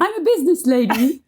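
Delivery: high put-on phone voice; mock business lady. Guest joining the host's bit, mock business lady. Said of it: "high-pitched telephone voice".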